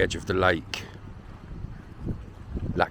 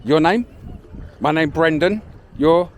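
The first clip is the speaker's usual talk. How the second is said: calling out; 9 semitones higher, 3 dB louder than usual. Real, not acted. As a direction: speak up clearly so they understand. Asking kids names loudly.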